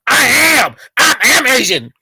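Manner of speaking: loud mocking yell of the clip line; mocking shout. Streamer shouting back the clip's line in mockery.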